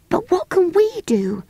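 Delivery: put-on high, worried young girl; little girl asking anxiously. A narrator's reading. Jane voiced high.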